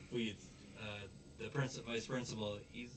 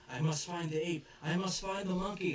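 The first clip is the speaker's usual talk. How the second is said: higher, louder frantic insistence; hammed-up desperate search; imitating a frantic father; mock urgent; 9 semitones higher, 9 dB louder than usual. Vice principal at costume party.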